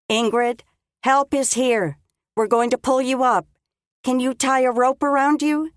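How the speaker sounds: calling down. Acted. Micah leans over the cliff calling down to Ingrid, loud. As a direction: call down so she can hear you. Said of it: "called to Ingrid again".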